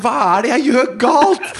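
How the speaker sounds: high, laughing plea of a frazzled mum; desperate mother. Retold or imitated. Mum, feeding the screaming baby, pleads high and laughing. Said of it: "ser hun meg sånn".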